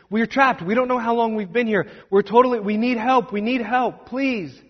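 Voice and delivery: high, breathless begging for help; frantic pleading. Acted. Trapped travelers begging the honeymoon couple for help, high and frantic. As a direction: plead with them, panicked.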